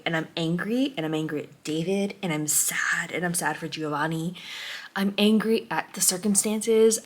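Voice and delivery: a broken whisper, barely voiced; whispered grief. Real, not acted. Reviewer near the end of the book whispers her sorrow to viewers. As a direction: breathe it out as if it hurts.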